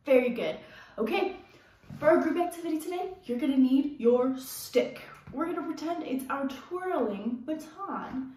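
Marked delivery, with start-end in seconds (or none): none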